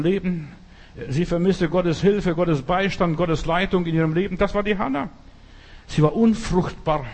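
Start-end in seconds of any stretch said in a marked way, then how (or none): none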